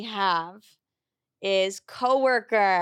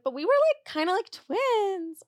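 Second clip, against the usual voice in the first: mocking high voice; 10 semitones higher than usual. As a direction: parody her own sappy claim. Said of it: "And I'm like".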